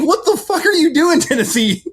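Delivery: high, incredulous outburst; exasperated disbelief. Retold or imitated. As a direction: yell it in disbelief at the team. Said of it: "You're like".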